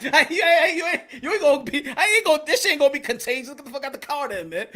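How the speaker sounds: loud, high, half-laughing mock outrage; retold loud eviction. He kicks the unsociable pair out of the car, loud mock outrage. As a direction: burst out as if throwing them out, amused. Acting out kicking them out.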